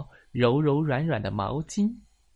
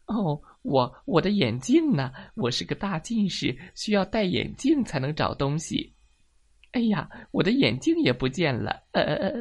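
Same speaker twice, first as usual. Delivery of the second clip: higher-pitched fretful searching; flustered hen; 6 semitones higher than usual. Acted. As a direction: fret aloud as a hen missing her glasses. Hen frantic without her glasses.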